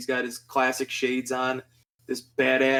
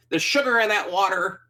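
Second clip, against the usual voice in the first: higher, put-on musician impression; 6 semitones higher than usual. Quoting the singer on an old album, higher imitation. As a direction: imitate the singer's affected voice, pitched up. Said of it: "when he's in Something Anything".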